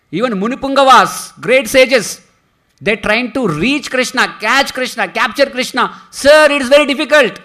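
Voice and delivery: loud, ringing preaching; forceful verse recital. Acted. Preacher recites the Sanskrit verse to the devotees at full force. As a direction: declaim the verse for the whole hall.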